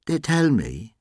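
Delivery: gently mocking character voice; soft mocking voice. A narrator's reading. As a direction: needle him gently with mock innocence. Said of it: "he said in a soft, mocking voice".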